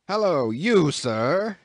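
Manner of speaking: cried out. A narrator's reading. Captain calling out to Queequeg, loud angry hail. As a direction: bark it across the deck. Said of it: "cried the captain".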